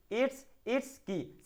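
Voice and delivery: "it's, it's की" said very slowly and softly; slow teaching model. Shown as a demonstration. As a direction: spell out "it's, it's की" word by word. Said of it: "इसकी के लिए क्या बोलोगे". (धीरे धीरे "it's, it's की")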